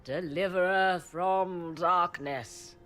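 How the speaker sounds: slow, loud, slurred mock toast of a tipsy emperor; Gaspard's drunken toast. Morrigan mimicking the emperor's drunken toast to the hero, slow and loud. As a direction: raise a glass and ham up a drunken salute. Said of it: "Gaspard drunkenly toasts in your honor".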